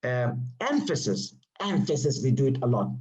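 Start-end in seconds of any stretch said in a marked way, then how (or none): none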